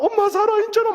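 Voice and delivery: high, loud hammed-up sob, laughing; mock tearful cry. Preacher retells hugging mom, hamming up tearful relief. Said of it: "막 이러면서".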